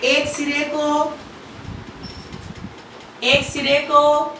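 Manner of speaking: slow, measured dictation with long pauses. Acted. Teacher dictating notes slowly to students, slow and measured. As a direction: spell it out word by word for note-taking.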